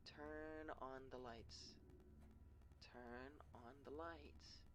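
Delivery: slow, soft eerie chant; creepy sing-song voice. Acted. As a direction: lure them in with a haunting lilt. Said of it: "hear a creepy sing-song voice".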